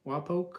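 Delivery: "Wapok" with a long o, rising; tentative pronunciation. Shown as a demonstration. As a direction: try "Wapok" like poke, as a question. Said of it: "is pronounced Wa".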